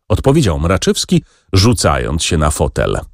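usual speaking voice

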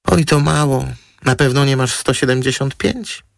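high-voiced model, mildly let down; high, girlish dialogue voice; chatty model. A narrator's reading. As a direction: pitch it well up like a fussy model.

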